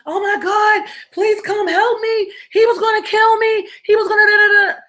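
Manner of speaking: high, wailing mock-hysterical plea, slowing down; hysterical crying. Calling the cops back, acting out hysterical crying. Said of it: "Now I'm all crying, hysterical".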